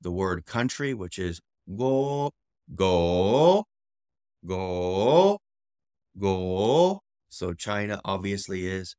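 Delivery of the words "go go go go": long rising GO; tone demonstration. Shown as a demonstration. Teacher demonstrates tone of guo.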